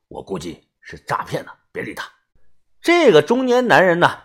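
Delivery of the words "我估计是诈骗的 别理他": low, very soft aside; hushed warning. A narrator's reading. Companion warning the narrator about a scam, low and hushed. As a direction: drop low and quiet so only your friend hears. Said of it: "于哥小声地说".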